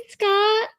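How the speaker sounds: very high, stretched name; calling out greeting. Real, not acted. Streamer greets Scott in chat, calling out high. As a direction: hail them in a high drawn-out call.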